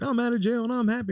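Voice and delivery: high-pitched imitation of a gleeful man. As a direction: mimic his happiness way up high. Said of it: "a clip that was like".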